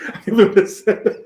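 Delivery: talking through laughter, high-pitched; real laughter. Real, not acted. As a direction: crack up at your own words. Cracking up at own crudeness.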